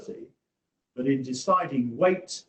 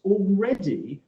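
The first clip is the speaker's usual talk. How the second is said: already raised high and punched; quoting opponents' emphasis on already; stress on already; 7 semitones higher than usual. Retold or imitated. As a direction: hit the word already hard. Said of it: "their emphasis".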